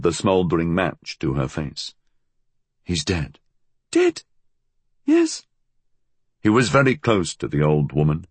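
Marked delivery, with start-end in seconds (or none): 2.8-3.4 man echoing in his natural pitch; understated male voice, doubting. A narrator's reading. Fool echoes her in disbelief. 3.9-5.5 put-on very high old-woman voice; high, agitated old crone; insistent old woman. A narrator's reading. Old woman confirms the death.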